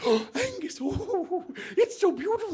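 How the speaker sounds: high, breathless admiring voice; swooning girlfriend. Acted. Bridget admires Angus's kilt, in a high swooning voice. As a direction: coo it like a smitten lass.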